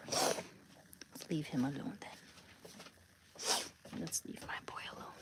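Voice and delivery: hushed whisper so the dog can rest. Real, not acted. Whispering to a friend beside the sleeping dog, hushed. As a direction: whisper softly, don't wake him.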